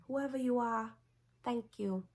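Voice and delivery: high, airy Lois voice; Lois impression. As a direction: play it as a grateful heroine, lightly over the top. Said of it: "and she was like".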